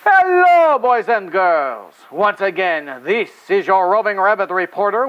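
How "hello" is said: high, loud greeting; cheery hello. Acted. Rabbit puppet greeting the boys and girls, loud and high. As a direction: call out to the kids.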